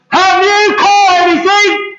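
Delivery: high, drawn-out call across water; big loud voice. Acted. Man on the seashore calls to the fishermen, big loud voice. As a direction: holler it to men far out at sea. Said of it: "he called out in a big loud voice".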